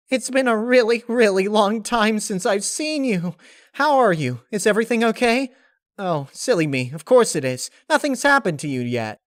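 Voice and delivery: very high, loud, bubbly pony voice; Pinkie Pie voice. Acted. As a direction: gush with teary joy.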